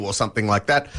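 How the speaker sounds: normal talking voice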